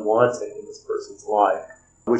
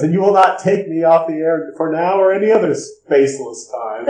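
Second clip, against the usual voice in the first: raised, insistent mock outburst; defiant declaration; 6 semitones higher, 4 dB louder than usual. Howard refuses to be taken off air, mildly defiant declamation. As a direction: declaim it as a mild defiant demand. Beale's defiant demand before collapsing.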